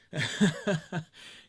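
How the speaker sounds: hearty loud laugh. Real, not acted. Laughs at his own joke.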